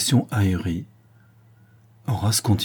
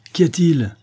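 louder, higher shouted question; calling out; 9 semitones higher, 6 dB louder than usual. Acted. Horace to whoever knocked at the door, calling out. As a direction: call sharply through a closed door.

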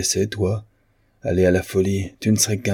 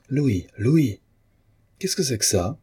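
higher, sharp angry questioning; mild anger, pitched up; angry demand; 7 semitones higher than usual. A narrator's reading. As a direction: confront him with anger. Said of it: "ajouta le peintre avec colère".

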